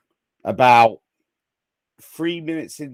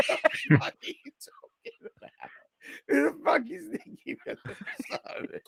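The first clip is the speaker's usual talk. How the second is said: high, helpless laughter through the words; wheezy mock indignation, giggling; laughing retelling; 10 semitones higher, 4 dB quieter than usual. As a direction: act out disbelief while cracking up. Retells quarrel while cracking up.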